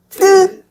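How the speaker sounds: 트 said tense and high; tense Korean tt. Shown as a demonstration. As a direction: pinch 트 tight and raise it. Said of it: "Add more tension with a higher pitch".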